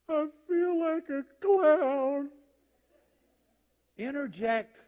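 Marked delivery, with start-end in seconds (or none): 0.1-2.3 high, loud, drawn-out wail; startling clown outburst. Acted. Sick man in clown wig startling a nurse, loud drawn-out wail. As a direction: sing it out slowly at a high, loud pitch.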